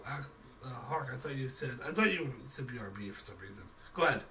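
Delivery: normal talking voice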